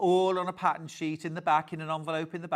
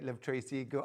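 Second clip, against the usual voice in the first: usual speaking voice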